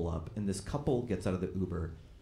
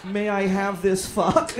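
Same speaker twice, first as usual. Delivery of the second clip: slow, high, loud and overly polite; mock formal wallflower; 9 semitones higher, 7 dB louder, slower than usual. Wallflower asking a woman to dance, slow high mock-formal. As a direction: ask grandly like at a school dance. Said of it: "wallflower asking at an orgy".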